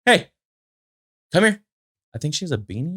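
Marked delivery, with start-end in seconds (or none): 0.0-1.6 high-pitched call to the pet; high dog-talk voice. Real, not acted. Talking to her dog Aspen, high pet-talk voice.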